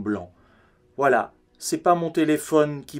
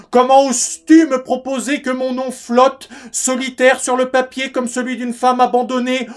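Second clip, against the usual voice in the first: higher-pitched, indignant mother on the phone; outraged mother; 9 semitones higher, 5 dB louder than usual. Acted. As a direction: reproach him in one sustained, offended sweep. [name] acts out his outraged mother. (mère outrée, ton de reproche aigu)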